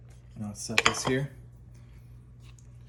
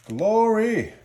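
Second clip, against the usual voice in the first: high, loud shout of triumph; triumphant shout; 12 semitones higher, 7 dB louder than usual. Real, not acted. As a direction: cry out in victory. Cracker breaks cleanly.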